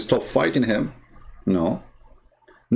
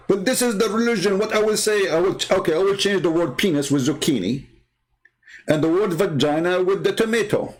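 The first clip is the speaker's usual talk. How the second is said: much higher, prim put-on voice; mocking a prissy chatter; 7 semitones higher than usual. Mimicking Christopher's prudish word swap in a high mock voice. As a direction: lampoon the squeamish viewer's word swap. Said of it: "He said".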